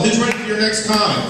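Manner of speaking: ordinary talk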